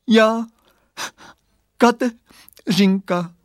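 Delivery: high, slow sobbing little voice; acted weeping small child; torn by crying. A narrator's reading. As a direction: break the words into tearful pieces. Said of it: "řekl hlásek pláčem potrhaný na kousky".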